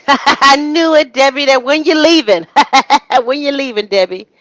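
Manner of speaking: mock his pushy check-in call; hammed-up pushy caller asking for Debbie; imitating him on the phone. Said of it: "Sound like he".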